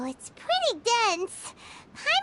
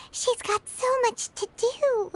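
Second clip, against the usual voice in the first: ordinary talk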